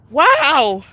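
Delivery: normal talking voice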